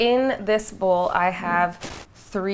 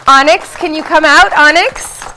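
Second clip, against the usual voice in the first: calling out; 8 semitones higher, 16 dB louder than usual. Real, not acted. Calling the dog from another room.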